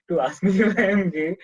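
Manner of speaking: amused, can't believe it; laughing disbelief. Retold or imitated. Telling colleagues who set up a meeting, laughing disbelief.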